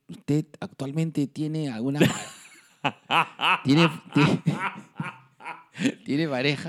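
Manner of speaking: real laughter. Real, not acted. Teller recalls blood donor interview, cracking up laughing. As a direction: try to get the question out through laughter. Cracking up while retelling the question.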